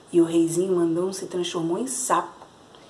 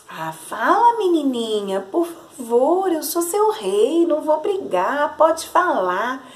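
slow, higher wheedling plea; playing sweet; 6 semitones higher, slower than usual. A narrator's reading. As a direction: sweet-talk the little girl into speaking. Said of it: "se fazendo de doce". (rei se fazendo de doce, devagar e mais agudo)

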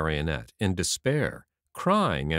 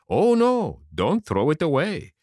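high, louder "Oh, no", then easing down; urgent protest; 7 semitones higher, 5 dB louder than usual. Acted. Geppetto stops the puppet throwing away a core, urgent protest. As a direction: jump in to stop him.

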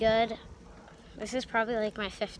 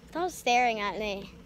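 ordinary delivery

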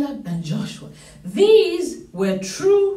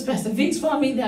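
normal talking voice

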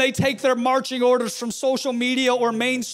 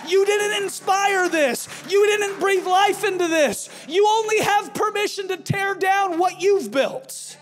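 preaching; 5 semitones higher than usual. Acted. Preaching build in sermon.

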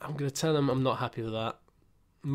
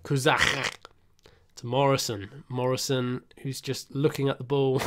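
normal talking voice

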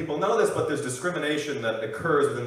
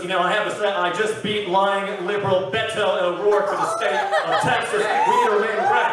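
loud, higher politician's victory speech; Ted Cruz; 6 semitones higher, 7 dB louder than usual. Acted. Senator crowing over beating a rival, loud oratorical voice. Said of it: "Ted Cruz".

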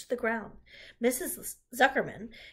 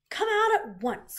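high, loud, drawn-out order; mother's raised-voice command; cried out; 13 semitones higher, 8 dB louder than usual. A narrator's reading. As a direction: call a child out firmly. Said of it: "cried Missus Arabelle".

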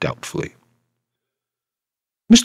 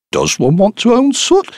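lightly voiced curious Crook; 13 semitones higher, 5 dB louder than usual. Crook asks, interested.

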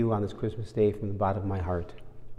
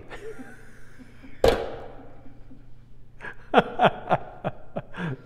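airy high laugh at his own joke; a quick, delighted laugh; breathy laugh; 9 semitones higher than usual. Real, not acted. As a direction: let a real laugh slip out after the punchline.

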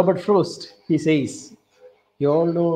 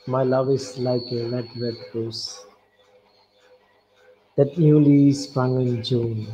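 poem recitation; 5 semitones lower than usual. Shown as a demonstration. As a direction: recite it like a love poem, line by line. Recites Burns poem slowly.

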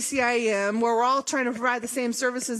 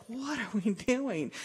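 slow, quiet, baffled disbelief; exasperated; 2 semitones lower, 6 dB quieter, slower than usual. Retold or imitated. Recalling the fights over contract technology, drawn-out exasperated sigh of a question. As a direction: let it drag out, half-despairing. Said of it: "it was just like".

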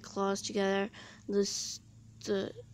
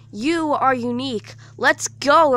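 urgent shout; 4 semitones higher, 12 dB louder than usual. A narrator's reading. Urging escape.

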